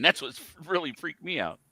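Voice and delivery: ordinary talk, nothing marked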